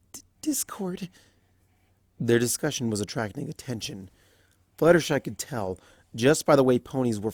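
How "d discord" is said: soft, breathless whispered stammer; whispered plea. Acted. Fluttershy hushing Discord in public, whispering. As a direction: hush him anxiously.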